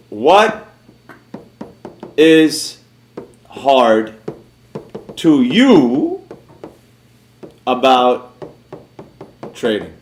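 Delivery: very slow, long pause after each word; halting on purpose, dropping low by the end; word-by-word question. Real, not acted. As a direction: spell the question out one word at a time. Spaces out question while waiting.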